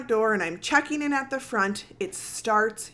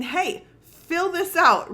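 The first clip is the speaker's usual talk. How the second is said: "Hey, fill this out" said slowly and higher, each word placed; slow, firm instruction; 6 semitones higher, 6 dB louder, much slower than usual. Shown as a demonstration. Giving a patient the sheet, slow firm direction. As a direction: hand it over and tell them plainly to do it. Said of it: "I'm giving this and I'm saying".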